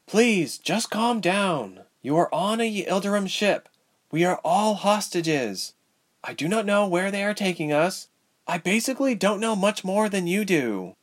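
high-pitched character voice, measured; Ilse steadying the others. A narrator's reading. Ilse calms and informs the hostages.

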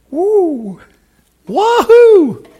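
very high, very loud celebratory whoops; joyful whoop. Real, not acted. Pastor whoops in joy to the congregation, very high. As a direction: whoop like the revival has come. Cheering about what is coming.

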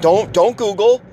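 mock girlfriend scolding him; upset girlfriend. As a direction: imitate the girlfriend nagging. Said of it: "She was like".

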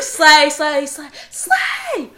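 hammed-up shrieking catchphrase; screamed slay. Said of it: "hammed-up catchphrase chant".

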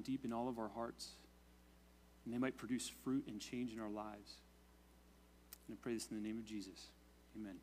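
quiet, reverent prayer voice. Real, not acted. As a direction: pray softly and humbly before the sermon. Pastor leads opening prayer.

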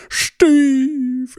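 high, very slow, stretched "Steve"; exaggerated Steve. Shown as a demonstration. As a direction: blow the name "Steve" up into a hammy drawl. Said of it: "we exaggerate and we elaborate".

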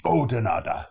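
loud mock-stern telling-off; booming, exaggerated OH-denarda; stern correction. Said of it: "look at us stern and go".

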